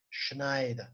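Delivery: Schneider slowly with a final A, Şnayda; drawn-out Schneider ending on A; German Schneider. Shown as a demonstration. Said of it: "A olarak telaffuz ediyoruz". (Schneider'i yavaş, sonu A ile: Şnayda)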